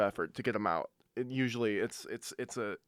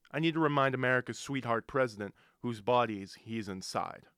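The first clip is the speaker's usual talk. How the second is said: ordinary talk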